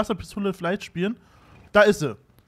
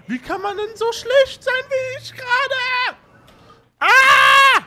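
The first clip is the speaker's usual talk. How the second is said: very high, strained and slow self-mocking cry; exasperated wail; 9 dB louder, slower than usual. Real, not acted. Gamer missing shots, wailing very high in exasperation. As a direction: squeal at your own bad aim, then a loud scream.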